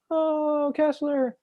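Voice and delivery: high, drawn-out sitcom wail; exaggerated high-pitched dismay; Jerry. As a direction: groan it up high in exasperation. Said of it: "ten out of ten impression".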